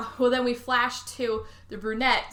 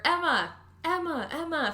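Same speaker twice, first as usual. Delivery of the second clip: calling out; 7 semitones higher than usual. Retold or imitated. Name called from outside pool.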